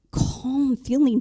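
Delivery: ordinary talk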